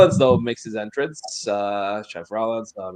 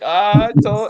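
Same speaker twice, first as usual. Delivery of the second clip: high, loud mock gloating; Seth Rollins; 11 semitones higher, 8 dB louder than usual. Wrestler laughing at his rivals, loud imitated gloating. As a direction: crow it in a put-on voice. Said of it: "Seth Rollins was laughing his ass off".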